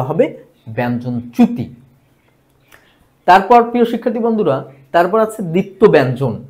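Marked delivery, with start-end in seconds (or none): none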